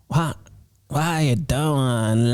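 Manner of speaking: ordinary talk, nothing marked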